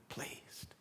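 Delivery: barely voiced, tender whisper. God speaking to a beloved child, whispered. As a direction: let it fade into a breath.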